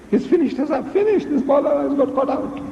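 high-pitched mock dismay; acted high, agitated outcry; crowd's alarm.

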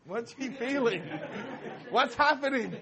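high, laughing, baffled question; laughing disbelief. Retold or imitated. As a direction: squeak it out through laughter.

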